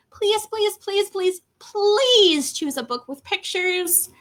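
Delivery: high, repeated begging; mock pleading. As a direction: beg as if worn out by it. Begs kid for another book.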